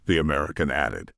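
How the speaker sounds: ordinary delivery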